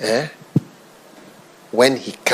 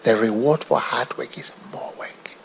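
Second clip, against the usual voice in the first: a hushed whisper of the preacher's words; whispered quote; 7 dB quieter than usual. Retold or imitated. Preacher quoting Reinhard Bonnke, whispering. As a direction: breathe it like a secret. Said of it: "He whispered".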